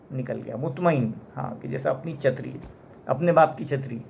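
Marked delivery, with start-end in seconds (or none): none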